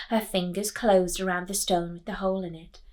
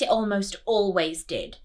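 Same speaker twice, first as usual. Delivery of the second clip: ordinary delivery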